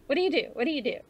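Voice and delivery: high, loud nosy questioner; mocking small-talk question. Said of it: "the first question they ask is".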